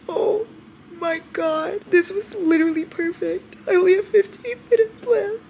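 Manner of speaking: very high, crying voice; overwhelmed, sobby high pitch; tearful wail. Real, not acted. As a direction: squeal it through happy tears. Overwhelmed as book nearly ends.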